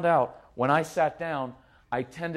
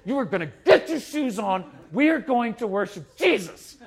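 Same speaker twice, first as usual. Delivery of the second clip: higher-pitched, hammed-up church-morning scolding; mock angry parent; 5 semitones higher, 3 dB louder than usual. Parent on the way to church, mock-furious ordering. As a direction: order the kids about, comically furious. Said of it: "Right on the way to church".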